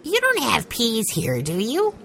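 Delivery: put-on child voice. A narrator's reading. Benny voiced in read story.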